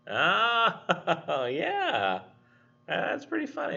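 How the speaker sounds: high laughing voice; amused laugh. Pretend. Worker amused by the bucket joke, laughing high.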